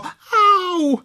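very high pained yelp. Acted. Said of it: "křičelo". (vysoké bolestné vyjeknutí)

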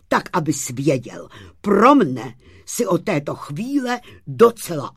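acted exasperation with punched words; indignant scolding. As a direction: tell the boaster off crossly. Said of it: "rozčílil se Bartoloměj".

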